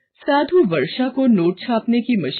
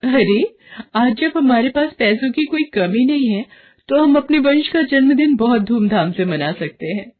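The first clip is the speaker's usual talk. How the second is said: higher and louder, full of herself; proud excitement; 6 semitones higher, 3 dB louder than usual. Acted. Varsha boasting to her husband about the birthday. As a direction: wave off any doubt, flaunting. Wife justifies lavish party.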